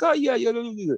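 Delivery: put-on high, babbling Irish kids; attempted Irish accent. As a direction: mimic little Irish children in a high voice. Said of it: "they've got beautiful little Irish accents".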